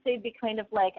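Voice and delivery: usual speaking voice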